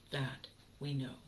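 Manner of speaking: quiet echo of the line. Shown as a demonstration. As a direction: drop it down, almost to yourself.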